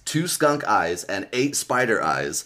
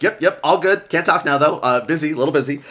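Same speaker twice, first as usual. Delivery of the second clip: higher, louder, hurried fake cheer; flustered cover-up; 6 semitones higher, 5 dB louder than usual. Chased character assuring friends all is fine, flustered cover-up. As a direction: brush someone off while frantically busy.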